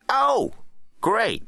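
very high, loud presidential retort; exasperated president. A narrator's reading. President answering his defence secretary, high and exasperated. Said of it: "replied President Clooney".

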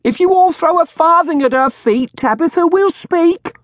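high, loud crone hawking; shrill old woman. A narrator's reading. Old woman asking the crowd for farthings, shrill. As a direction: call shrilly to the whole crowd.

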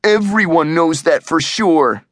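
mildly indignant objection; protesting. A narrator's reading. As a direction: contradict the friend, stressing "Everyone". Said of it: "Eugene protested".

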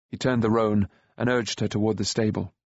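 usual speaking voice

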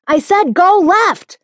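high, loud, slow insistent yell; yelling again. A narrator's reading. Passenger yelling again at the driver, furious. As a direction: drag out the repeated order. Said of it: "The passenger yells again".

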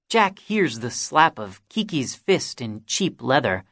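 usual speaking voice

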